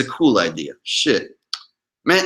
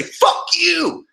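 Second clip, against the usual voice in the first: high, acted angry yell; emotional outburst; 13 semitones higher than usual. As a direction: act out losing your temper on the phone. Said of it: "my emotions get a hold of me".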